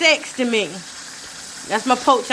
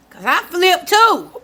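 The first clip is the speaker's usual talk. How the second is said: laughing; 5 semitones higher, slower than usual. Real, not acted. Laughs at own remark.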